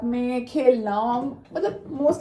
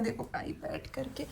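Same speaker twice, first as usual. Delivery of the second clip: whisper; 14 dB quieter than usual. Real, not acted. Settling in by the window.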